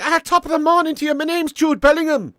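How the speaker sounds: very high, loud stage-Irish voice; mock Irish accent. Streamer mocking the idea Bellingham is Irish, exaggerated Irish accent. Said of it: "He's not like".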